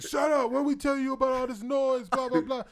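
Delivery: high loud mock yell; cop yelling. Cop yelling at laughing prisoners, mock shout. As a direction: bark at the prisoners to shut up. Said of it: "he's like".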